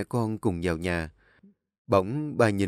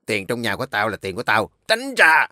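put-on drunk angry husband; 6 dB louder than usual. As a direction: shove her off with a yell. Drunk husband snaps at wife.

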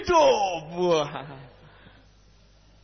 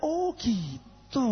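calm, unbothered; 5 semitones higher, much slower than usual. Retold or imitated. Calm reply to critic.

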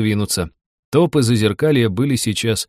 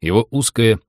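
ordinary delivery

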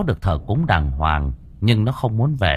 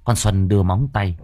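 ordinary delivery

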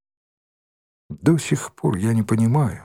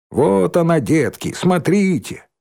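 high, hammed-up alarm; playful fairground cry about a monster; mocking show-off warning; 6 semitones higher than usual. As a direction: call the kiddies over, pitched up. Mocking boy warns the little kids.

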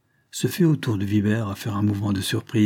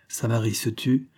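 usual speaking voice

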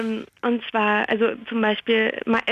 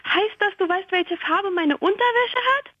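put-on higher, alarmed girl's voice; a startled fourteen-year-old asking in a higher pitch; teenage girl; 9 semitones higher than usual. Teenage girl asks the mind-reading lion, higher and alarmed.

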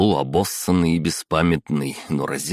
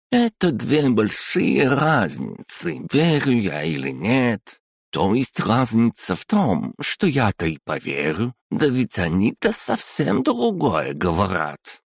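put-on high, slow Odessa accent; одесский акцент; 6 semitones higher, slower than usual. A narrator's reading. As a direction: drawl it in a thick Odessa manner. Interpreter's Odessa-style reply. (с одесским акцентом, нараспев)

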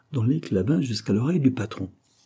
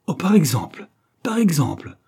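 high, louder indignant exclamation; quivering indignation; 5 semitones higher, 5 dB louder than usual. A narrator's reading. Employer outraged at his chauffeur's conduct, indignant. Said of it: "frémit-il".

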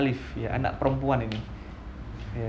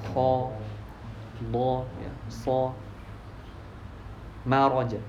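usual speaking voice

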